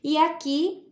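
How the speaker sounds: ordinary talk, nothing marked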